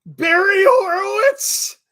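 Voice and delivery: high, loud incredulous shouts; shocked disbelief. Real, not acted. Watching wrestling with a friend, shrieking the name in disbelief.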